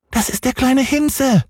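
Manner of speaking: hohe, quengelnde Kinderstimme; kleiner Junge schreit. Acted. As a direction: fordernd wie ein ungeduldiges Kind. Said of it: "sagte Häwelmann". (hoch und fordernd gerufen)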